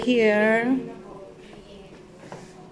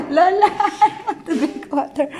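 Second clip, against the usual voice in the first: laughing; 5 semitones higher than usual. Real, not acted. Coaxing lola while laughing.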